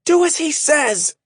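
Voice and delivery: high, loud commanding shout; Shay shouting the order to the men. A narrator's reading. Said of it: "Then she shouted".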